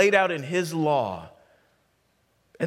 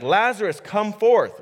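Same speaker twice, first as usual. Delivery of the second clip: loud, raised command; calling out; 6 semitones higher, 6 dB louder than usual. Acted. Preacher as Jesus calling Lazarus out of the tomb, commanding. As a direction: summon someone out from afar, pitched up.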